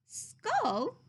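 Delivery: high puzzled question; a boy baffled by the word. Acted. Christopher Robin puzzled.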